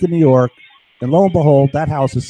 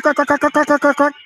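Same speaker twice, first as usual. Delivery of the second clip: high sharp crack crack sounds, much higher; imitated zapper cracks; 12 semitones higher than usual. Telling the camera about his zapper, high imitated cracks. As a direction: mimic the zapper clicking in a high voice. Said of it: "And I go crack".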